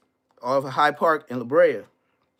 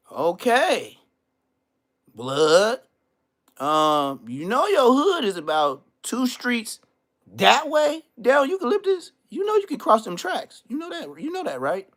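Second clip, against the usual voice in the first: mock lecturing rival; 9 semitones higher, 4 dB louder than usual.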